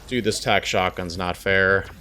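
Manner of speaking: usual speaking voice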